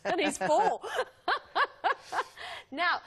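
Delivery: laughing hard while talking. Real, not acted. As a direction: crack up mid-sentence. Laughing at own story.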